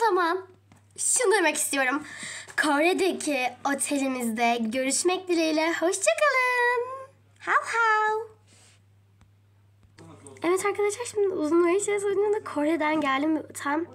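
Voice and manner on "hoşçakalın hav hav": high, slow, fake-crying farewell; mock-tearful goodbye; whiny goodbye. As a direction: whimper the goodbye like a sad puppy.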